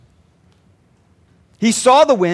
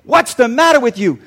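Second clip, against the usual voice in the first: louder, mildly exasperated reproach; a touch of acted rebuke; mock scolding; 3 semitones higher, 6 dB louder than usual. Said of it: "He's not saying".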